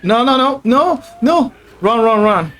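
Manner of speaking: loud, high panicked "no" and "run"; frantic fleeing cries; being chased. Real, not acted.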